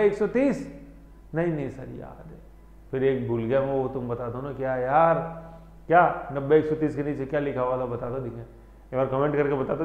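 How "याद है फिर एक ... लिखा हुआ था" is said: ordinary delivery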